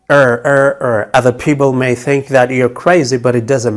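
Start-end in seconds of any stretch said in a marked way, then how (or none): 0.1-1.1 er er er, the vowel held; drawn er sound. Shown as a demonstration. Teacher drilling the er sound for learners. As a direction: repeat the er sound slowly. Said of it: "You can say it this way".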